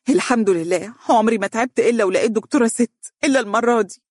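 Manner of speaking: rising indignation as she insists; agitated. Acted. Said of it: "قالت بانفعال". (بانفعال وحدة بصوت أعلى)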